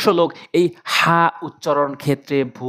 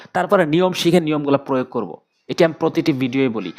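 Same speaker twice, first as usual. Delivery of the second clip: usual speaking voice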